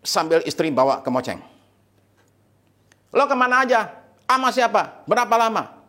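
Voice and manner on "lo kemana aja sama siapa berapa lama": high-pitched mock interrogation; interrogating wife. Wife confronting the husband who slipped away, accusing.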